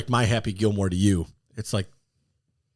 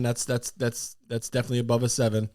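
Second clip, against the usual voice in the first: ordinary talk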